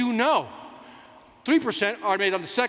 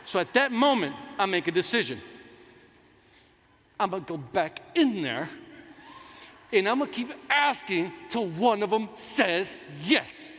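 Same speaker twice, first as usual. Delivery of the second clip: ordinary delivery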